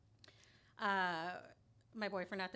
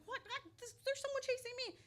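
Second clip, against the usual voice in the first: much higher, desperate plea; frantic pleading; 3 dB louder than usual. Retold or imitated. Pleading with the cab driver about her pursuer, frantic.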